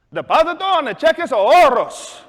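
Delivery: loud, high Spanish recital; eager and exaggerated; overdone retry. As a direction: push the phrase out emphatically to be understood. Retries phrase louder for silent customer.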